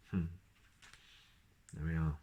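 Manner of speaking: plain reading voice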